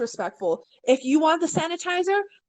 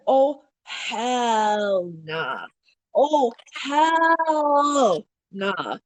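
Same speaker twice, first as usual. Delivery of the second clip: drawn out very slowly, falling; slow disbelieving hell nah; 2 semitones lower, much slower than usual. Retold or imitated. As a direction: stretch each word, flat refusal. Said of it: "I'm like".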